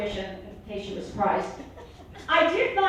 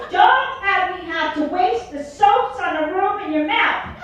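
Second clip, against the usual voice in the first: higher, louder, slow scolding mom; hammed-up bossy mom; mother's voice; 7 semitones higher, 8 dB louder, slower than usual. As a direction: scold like an old-school mother. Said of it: "She goes".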